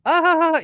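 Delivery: loud falsetto ha ha ha; hammy high laugh. Retold or imitated. Mocking laugh of the prankster.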